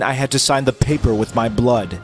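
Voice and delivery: ordinary delivery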